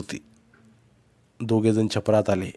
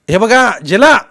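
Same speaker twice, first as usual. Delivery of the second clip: high, full-voiced call; shouted warning; 12 semitones higher, 11 dB louder, slower than usual. A narrator's reading. Boy releasing the bundle to uncle, shouting. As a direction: shout to catch it now. Nephew throws across the stream.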